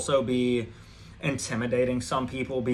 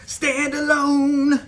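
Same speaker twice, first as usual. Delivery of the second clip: dramatic high echo; 13 semitones higher, 6 dB louder, much slower than usual. Acted. Defying the coven, a drawn-out high proud echo. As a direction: stand alone, much higher, louder and slow. Repeats the phrase for emphasis.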